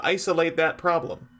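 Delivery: ordinary talk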